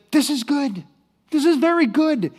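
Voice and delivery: high, emphatic cheerful verdict; relentlessly positive. Acted. Servant answering the furious king, relentlessly positive. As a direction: insist on it with unshaken optimism. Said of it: "infectiously positive attitude".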